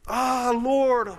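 high, loud cry to the Lord; a desperate listener calling to God; anguished cry. Retold or imitated. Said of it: "you're saying".

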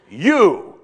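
YOU called out high and loud; emphatic YOU. Shown as a demonstration. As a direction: throw out the word You with full weight. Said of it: "so this is emphatic".

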